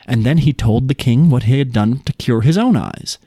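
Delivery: ordinary delivery, nothing marked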